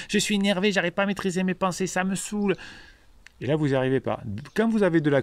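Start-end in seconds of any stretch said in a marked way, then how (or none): 0.1-2.5 higher pitched mock whine of a frustrated pirate; imitating an angry follower. Coach mocks a follower's angry comment, higher mock whine. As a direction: play the sulky follower who can't calm down. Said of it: "Et puis vous allez".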